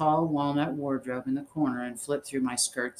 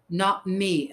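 higher, forceful retort; a restrained narrator's yell, pitched up; 6 semitones higher, 4 dB louder than usual. A narrator's reading. Elsie contradicts her friend about mothers, yelling. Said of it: "Elsie yelled so loud".

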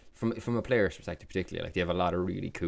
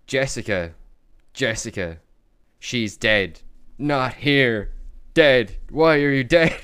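mock dramatic slam poet, louder and higher; 4 semitones higher, 8 dB louder, slower than usual. Retelling Jonah Hill's slam poem to a friend, mock overwrought and loud. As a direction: ham up an overwrought poetry slam. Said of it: "and he's like".

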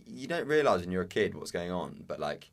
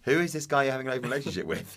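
raised, higher indignant question; mock confrontation; 6 semitones higher, 4 dB louder than usual. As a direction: playfully confront your mum, voice lifted. Acted confronting of the mother.

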